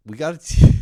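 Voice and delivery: very loud eager start; excited. Retold or imitated. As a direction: blurt it out eagerly. Excited quoted girlfriend.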